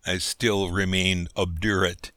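plain reading voice

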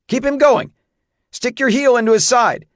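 high, loud coaching shouts; urgent shouted orders. A narrator's reading. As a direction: shout instructions to a rider in trouble. Urgent orders during horse runaway.

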